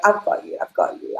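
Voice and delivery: soft unvoiced whisper to herself; whispered comfort. Whispering comfort to her younger self. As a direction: hush it like soothing a scared child.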